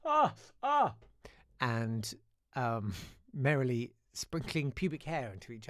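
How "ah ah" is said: high, loud "ah" noises; two loud high-pitched vocal yelps; imitated sound effect. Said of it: "while Dylan was going".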